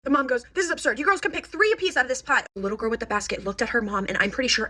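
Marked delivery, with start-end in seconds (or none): 0.5-2.4 high, exasperated reprimand; scolding mom. Retold or imitated. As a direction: imitate a fed-up mother laying down limits. Mom scolding girls, acted.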